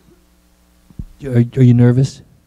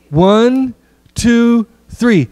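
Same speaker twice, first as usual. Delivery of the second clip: counting in; 8 semitones higher, much slower than usual. Real, not acted. Counting group in for cheer.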